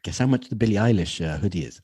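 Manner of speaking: ordinary talk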